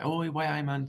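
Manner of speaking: a quick high-pitched Newcastle hello; Geordie accent. Retold or imitated. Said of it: "So they might say".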